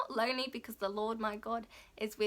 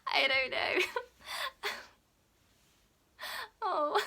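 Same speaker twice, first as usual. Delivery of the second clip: tearful; 8 semitones higher, much slower than usual. Real, not acted. Overwhelmed, laughing into tears.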